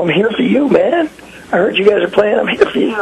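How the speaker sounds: high-pitched retold pleading; earnest protest. Fan telling grumpy drummer why he came, protesting. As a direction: insist eagerly, pitch climbing.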